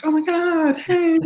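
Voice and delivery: put-on high-pitched girly squeal of greeting; excited party girls. Imitating girls at the bars after a win, high and gushing. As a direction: mimic girls greeting each other in a very high voice. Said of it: "girls just being like".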